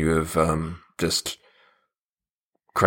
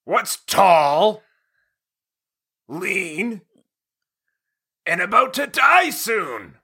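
high, sing-song cartoon riddle; Bony voice; 11 semitones higher, slower than usual. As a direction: pose the riddle in a high goofy voice. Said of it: "Dave, you're bony".

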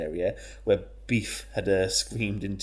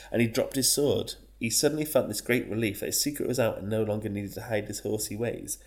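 ordinary talk, nothing marked

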